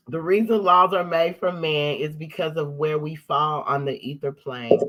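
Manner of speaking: ordinary talk, nothing marked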